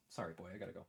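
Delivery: low, very quiet aside; soft apology. Real, not acted. Streamer telling his dog he must go, soft apology. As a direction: murmur a gentle sorry to your dog.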